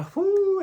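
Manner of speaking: sharp high exhale; high loud whew. Real, not acted. Vlogger reacting to the fight, high whew. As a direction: blow out a shocked whew.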